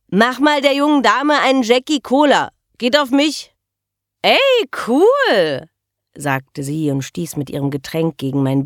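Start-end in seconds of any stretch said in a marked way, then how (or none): 0.1-3.5 raised, loud voice over the din; shouted order. Acted. Man buying the girl a drink, shouting to Ronny. 4.2-5.7 high, loud cheer over the noise; pleased shout. Acted. Girl thanking the man for her drink, shouting.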